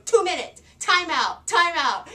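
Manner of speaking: put-on high, loud child giving orders; a toddler firmly announcing the punishment; stern little girl. Daughter orders timeout, voiced.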